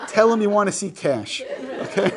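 laughing through a joke. Real, not acted. Teacher joking to class while laughing. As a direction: tease the class with a chuckle.

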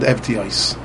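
Evtios softly, ev-tee-oys with final s; samech ending. Shown as a demonstration. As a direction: say "Evtios" low and soft, ending on s. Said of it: "with a Samich in the end".

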